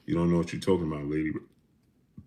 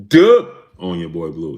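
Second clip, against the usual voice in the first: louder, punchy donor call; hype shoutout; 9 dB louder than usual. Acted. Streamer shouting out a donor, louder hype call. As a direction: call it out to the room. Super chat shoutout catchphrase.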